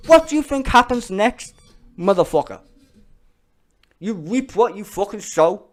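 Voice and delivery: loud, high angry challenge; furious rant. Real, not acted. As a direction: yell the question at them. Ranting at racists.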